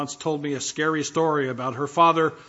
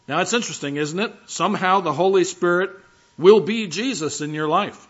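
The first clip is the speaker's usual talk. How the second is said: normal talking voice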